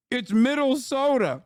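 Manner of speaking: mock pun. Real, not acted. Joke pun on Minnesota.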